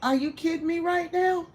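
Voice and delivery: retelling friends' stunned outcry; disbelief. Retold or imitated. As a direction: screech it in shock. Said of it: "we was like".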